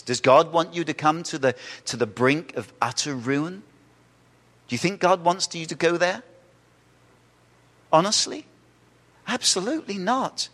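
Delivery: normal talking voice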